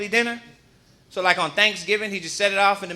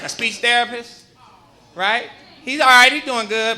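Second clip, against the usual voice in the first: usual speaking voice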